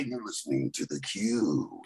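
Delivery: slow, low, soft radio announcer; radio intro voice. Pretend. Radio intro mashup for a station, slow low announcer voice. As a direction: drawl the station ID like a DJ. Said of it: "a radio intro".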